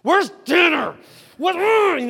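high, loud, confused just-woke voice; groggy grumpy wake-up. Retold or imitated. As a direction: wake up cranky and lost. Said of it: "you're just like".

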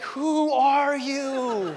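slow, drawn-out mock disbelief with a laugh; wife staring at husband, incredulous. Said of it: "your wife's looking at you like".

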